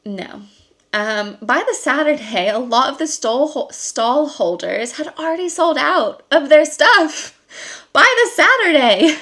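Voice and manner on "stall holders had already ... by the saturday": high, loud incredulous exclamation; louder and higher in disbelief; amused disbelief. Real, not acted. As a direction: exclaim it, astonished, on the edge of laughing.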